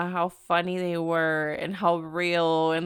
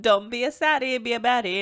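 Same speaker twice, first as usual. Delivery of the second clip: louder, punchy motivational call; a lively self-quote, said louder; pep-talk slogan; 3 semitones higher, 5 dB louder than usual. Retold or imitated. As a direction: hype yourself up with a louder slogan. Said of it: "I just said last week".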